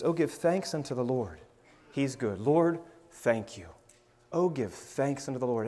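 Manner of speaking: unhurried, reflective scripture with pauses; meditative reading. Shown as a demonstration. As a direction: dwell on each phrase, then pray it back. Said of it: "Meditation says".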